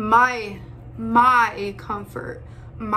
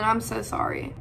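normal talking voice

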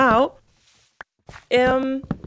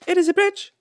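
high, loud emphatic cheer; excited triumph; 10 semitones higher, 7 dB louder than usual. Real, not acted. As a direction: insist on it loudly and high. Repeats triumph over bridge.

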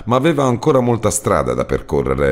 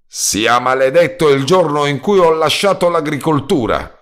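high, louder cry to the heavens; exclaimed curse; 10 semitones higher, 3 dB louder than usual. A narrator's reading. Roadman cursing at the universe, exclaiming loud and high. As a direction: curse the day out loud to the sky. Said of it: "esclamò come se si rivolgesse all'universo".

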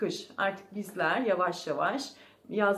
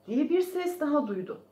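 plain reading voice

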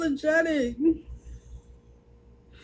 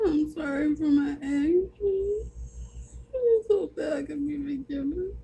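real tears, begging forgiveness; weeping sorry to the viewers; tearful plea; 3 dB quieter than usual. Real, not acted. Liz's tearful apology on live.

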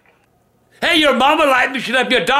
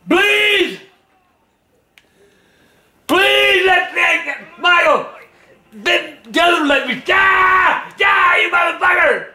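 desperate angry pleading; 3 semitones higher, much slower than usual. Real, not acted. Begging then raging at game.

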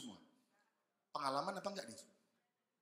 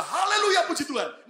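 high, loud laughing hallelujah; mock hallelujah; 12 semitones higher, 8 dB louder than usual. Caller who finally has good news, high laughing hallelujah. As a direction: squeal it out like a happy caller.

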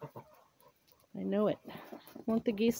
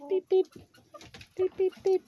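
short, high-pitched beeps; playful beeping; 9 semitones higher, 3 dB louder than usual. Pretend. Playing among the hens, high beep noises. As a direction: imitate little beeps in a high voice.

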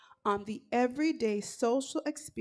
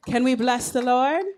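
loud, rising call to the congregation; rousing call; 3 semitones higher, 11 dB louder than usual. Real, not acted. Worship leader urges congregation to respond, real rousing call. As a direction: belt out the question to rouse worshippers.

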